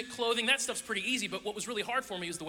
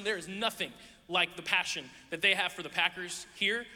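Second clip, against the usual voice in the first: usual speaking voice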